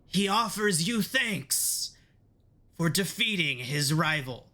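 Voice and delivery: high, slow, proclaiming imp voice; little imp. Acted. The imp announces its devil master to the party, high and grand. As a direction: herald the warlord like a tiny squeaky imp. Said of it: "a little imp hops up onto his shoulder and says".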